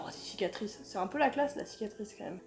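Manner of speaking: softly awed whisper; hushed. Real, not acted. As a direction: murmur it in admiration. Admiring scars, dropping voice.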